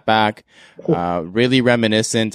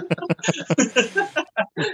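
high laughter; 3 dB quieter than usual. Real, not acted. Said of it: "Uh, good try".

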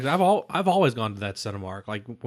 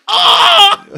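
mock scream; 13 dB louder than usual. Video guys scream when lights come on.

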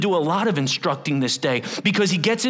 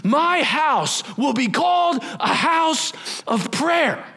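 yelling; 8 semitones higher, slower than usual. Retold or imitated. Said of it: "and he yells".

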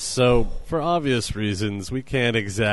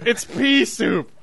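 mock triumphant reveal; 12 semitones higher, 3 dB louder, slower than usual. Joking reveal to wife.

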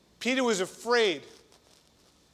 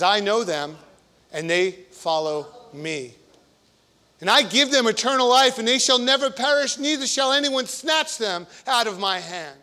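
loud, proclaiming scripture voice; fervent Bible reading; 2 semitones higher, 6 dB louder than usual. Acted. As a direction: declare the promise boldly.

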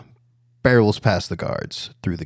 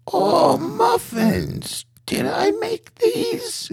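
very high, very slow, quavering granny voice; Ma's wavering old voice; much slower than usual. Acted. Ma Scamarelli marvelling at the muffins, high and quavering. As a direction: coo it slowly like a confused old grandmother. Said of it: "A flood of muffins pour out".

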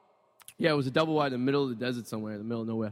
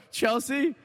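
high, shouted question; excited hometown guess; eager guess; 9 semitones higher than usual. Retold or imitated. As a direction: call out the guess hopefully. Asking the guy where from.

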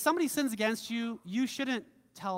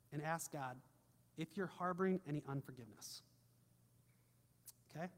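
quiet prayer lead; 5 semitones lower, 8 dB quieter than usual. Acted.

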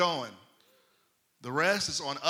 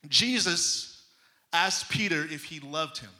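ordinary talk, nothing marked

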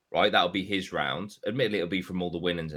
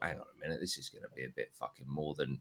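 muttered inner thought, softer; 6 semitones lower, 6 dB quieter, faster than usual. Retold or imitated. Thinking it over quietly at the bar, hushed inner voice. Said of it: "And I thought".

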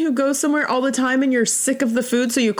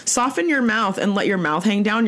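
usual speaking voice

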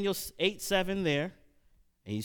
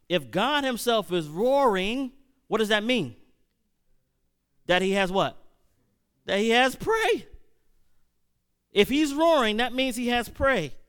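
loud, raised-pitch preacher building to a peak; forceful preaching; 6 semitones higher, 6 dB louder than usual. Real, not acted. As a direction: drive the point home to the congregation.

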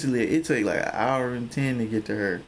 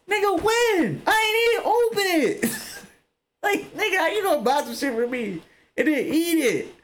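outraged shout. Retold or imitated. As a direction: yell it in disbelief, half laughing. Outraged over eaten cereal.

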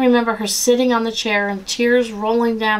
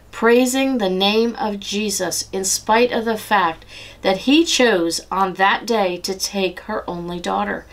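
normal talking voice

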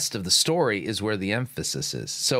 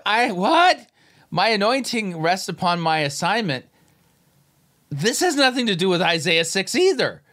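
much higher and louder incredulous rant; exasperated rant; 10 semitones higher, 6 dB louder than usual. Real, not acted. Commentator reacting to the sermon clip, shouting in exasperated disbelief. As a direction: tear into it, fed up and baffled. Ranting at preacher's nonsense.

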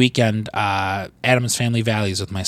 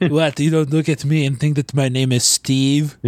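put-on indignant Austrian accent, lightly done; mock Austrian accent; 3 semitones higher than usual. Arnold character insisting on his name, light Austrian accent. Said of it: "with your fucking accent".

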